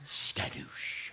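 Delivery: ordinary talk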